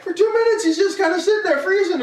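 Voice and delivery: high, exasperated retelling. Real, not acted. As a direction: complain with rising pitch. Exasperated about the stream freezing.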